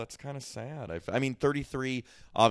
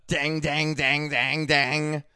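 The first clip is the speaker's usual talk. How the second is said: loud, high, slightly off voice; a light Rebecca Gayheart impression; 6 semitones higher, 8 dB louder, much slower than usual. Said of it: "Do I do a good Rebecca Gayheart impression?".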